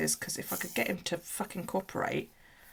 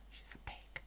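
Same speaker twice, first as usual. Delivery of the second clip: whisper; 23 dB quieter than usual. Real, not acted. Teasing aside about the snake.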